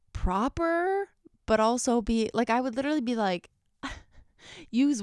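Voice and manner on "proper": mock proper. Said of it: "the way that I was writing was, like, so weirdly".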